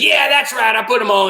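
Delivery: high, loud cheeky boast; gleeful octopus. Acted. Octopus boasts about moving monsters, gleeful. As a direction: crow it with mischievous pride.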